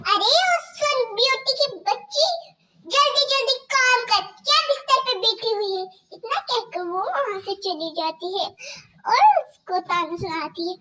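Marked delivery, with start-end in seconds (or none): 0.1-5.8 higher, louder mocking stepmother voice; harsh stepmother scolding. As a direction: bark orders at the girl like a cruel stepmother.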